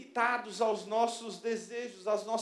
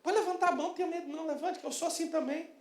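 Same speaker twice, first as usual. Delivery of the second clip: ordinary talk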